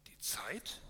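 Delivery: hushed, whispered question rising in disbelief; astonished echo. Acted. Love echoing Calmness in disbelief, a whispered astonished question. Love echoes the answer amazed.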